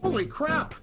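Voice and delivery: usual speaking voice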